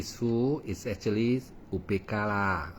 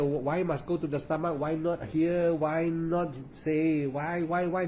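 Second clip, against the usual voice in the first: high-pitched, nagging run of whys; mock questioning student; 7 semitones higher than usual. Teacher mimicking students who question the instruction, mock questioning. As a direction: imitate a pupil who keeps asking why. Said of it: "So don't say that".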